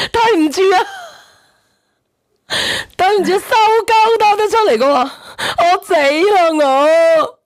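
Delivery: can't stop laughing while apologising; laughing apology. Real, not acted. (笑到停唔到噉道歉)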